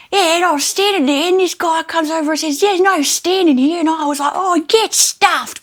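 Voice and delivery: high, loud broad Australian bogan voice; bogan Australian accent. Retold or imitated. Retelling a bogan lady in the car park, loud broad Australian accent. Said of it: "in a voice very Australian like that".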